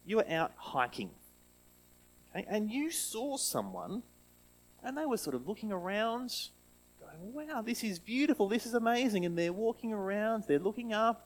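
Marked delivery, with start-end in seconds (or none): 2.5-11.3 put-on higher, lively storytelling voice for kids; reading to children. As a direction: paint the scene for the little ones.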